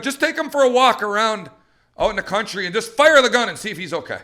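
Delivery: loud, high, breezy bad advice; mocking trap club. Trainer ridiculing trap club advice to owners, mocking imitation. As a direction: mimic a know-it-all gun club member. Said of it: "according to the trap club".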